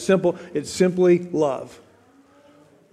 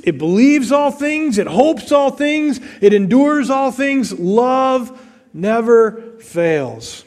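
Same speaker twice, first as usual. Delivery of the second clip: slow, higher, solemn preaching of each line; scripture reading; 4 semitones higher, slower than usual. Acted. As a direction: let each verse ring out slowly, building. Reads First Corinthians thirteen.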